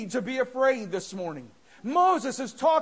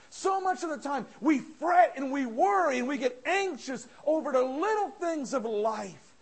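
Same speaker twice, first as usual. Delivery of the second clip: ordinary talk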